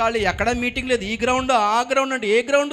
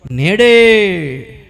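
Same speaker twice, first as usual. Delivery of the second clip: నేడే proclaimed loud and long; one drawn-out, loud నేడే; stress on నేడే; 8 dB louder, much slower than usual. Retold or imitated. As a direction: stretch నేడే out in a ringing call. Preacher emphasises Christ's promise of today.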